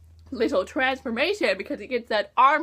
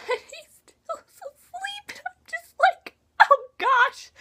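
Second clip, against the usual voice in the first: much higher, teary laugh-cry; half-laughing wail; slower than usual. Real, not acted. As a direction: wail in mock despair, laughing through it. Fears the brother is dead.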